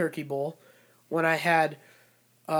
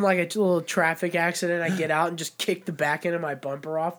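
ordinary delivery

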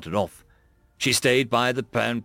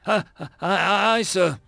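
higher, halting reply; stuttering; 6 semitones higher than usual. A narrator's reading. Lieutenant stammers his reply to the colonel, stuttering. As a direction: stammer out an acknowledgement. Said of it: "He didn't wait for Mayer to stutter".

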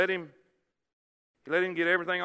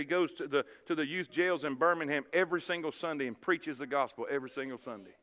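ordinary talk, nothing marked

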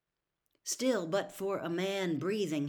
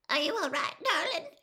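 much higher, worried fussing; anxious aunt; 12 semitones higher, 4 dB louder than usual. A narrator's reading. Aunt frees the shaken girl from her costume, anxious and high. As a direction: fret over the child. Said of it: "she asked over and over".

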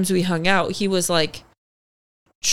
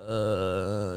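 much lower, slow and softer, a hint of a dopey guy; lightly voiced dull date hesitating; 12 semitones lower, 9 dB quieter than usual. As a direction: drag it out like a bored dud.

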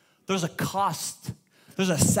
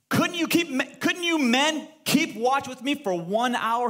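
high, slow, pained question; hurt disbelief; 6 semitones higher, slower than usual. Acted. Jesus asks his sleeping disciples, pained and pleading. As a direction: plead with them, higher and drawn out.

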